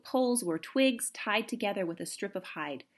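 plain reading voice

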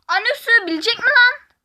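high, loud excited question; excited disbelief. Real, not acted. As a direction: blurt it out, amazed. Tahmine heyecanlı şaşkınlık. (heyecanla şaşkın soru)

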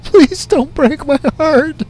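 pleading with his fiancée not to go, mock heartbroken; melodramatic plea. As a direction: beg like a soap opera lover. Melodramatic plea to departing love.

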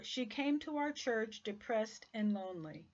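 ordinary talk, nothing marked

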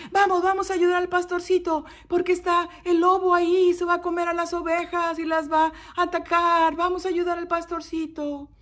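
high, loud, urgent rallying cry; townspeople rallying. Acted. Townspeople rushing to help the little shepherd, urgent and high. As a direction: rouse the whole village to help, pitched high.

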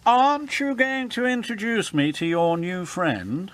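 high-pitched, grand questioning; sniffy grand engine. A narrator's reading. Elizabeth expects an introduction from Emily, sniffily. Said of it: "sniffed Elizabeth".

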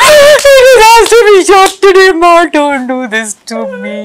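high, loud imitated director's plea; mock desperate wail. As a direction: wail it out, half laughing. Acting out the exasperated director pleading.

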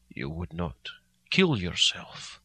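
low, very slow hoarse question; hoarsely. A narrator's reading. Van Helsing hoarsely asking Mina if she'd kill herself. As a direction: drag it out, voice catching. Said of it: "he asked hoarsely".

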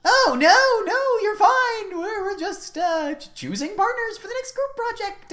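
high, flustered stammering; nervous reassurance. Acted. Teacher facing intimidating late student, nervously flustered. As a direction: reassure hastily in a high, hesitant voice.

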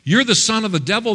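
high, quick mock accusation; accusing voices. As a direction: throw it at him like a taunting accuser. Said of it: "the voices that were coming at him".

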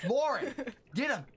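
rising shouts at a friend in the game; excited yelling. Real, not acted. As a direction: yell at her to attack. Urging partner in game fight.

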